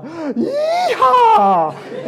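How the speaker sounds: high, loud whooping yeehaw with a laugh; Texas yeehaw. Retold or imitated. Pastor retelling the cold-water jump, loud whooping yeehaw. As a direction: whoop it out like a cowboy. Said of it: "back to my Texas days".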